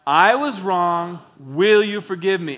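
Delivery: loud, slow, deliberate call to repeat; leading group recitation. Shown as a demonstration. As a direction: project it for a congregation to join in. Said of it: "Let's say it again".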